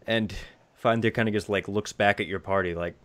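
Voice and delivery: ordinary talk, nothing marked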